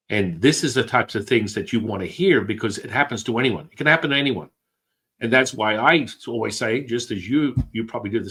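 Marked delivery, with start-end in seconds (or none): none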